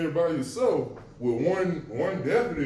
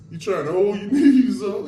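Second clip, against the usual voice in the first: laughing; 5 semitones higher, 7 dB louder than usual. Real, not acted. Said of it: "I'm laughing".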